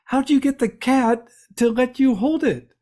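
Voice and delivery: a hint of the wife asking, amazed; wife's voice. Husband quoting his wife's surprised question.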